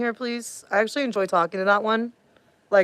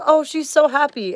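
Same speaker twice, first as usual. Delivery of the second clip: higher, louder imitation of a fooled listener; mock admiring remark; 7 semitones higher, 5 dB louder than usual. Imitating listeners fooled by a happy tone, mock admiring. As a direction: gush in mock approval. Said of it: "And you're like".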